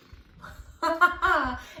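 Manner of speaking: short real laugh of amusement; little laugh at her own joke; amused laugh. Real, not acted. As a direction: burst into a quick giggle. Teacher amused at the sleep line.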